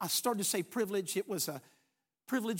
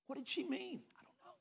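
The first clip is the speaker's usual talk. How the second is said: hushed whispered question; whispered wondering aloud; 5 semitones higher, 12 dB quieter than usual. Real, not acted. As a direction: drop to a breathless whisper. Preacher ponders her last words.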